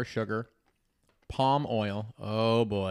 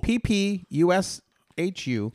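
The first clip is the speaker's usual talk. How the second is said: slow spelling out; 3 semitones higher, slower than usual. Shown as a demonstration. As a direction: spell it letter by letter slowly. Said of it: "spelling the name slowly".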